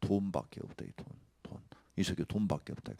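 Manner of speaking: unvoiced hushed whisper; quiet whispered rebuke. Real, not acted. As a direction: whisper it like a secret sin. Whispered accusation.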